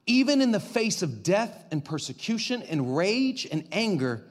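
usual speaking voice

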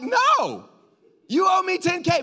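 high, loud mock demand; shouted demand for ten K. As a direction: yell it like a creditor who wants his money. Pastor acts creditor demanding money.

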